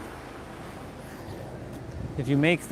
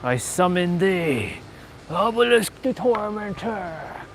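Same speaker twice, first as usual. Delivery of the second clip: slow, grand, higher summoning call; dramatic summon; 4 semitones higher, slower than usual. Pretend. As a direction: call it out like an anime duelist. Playacting a card summon.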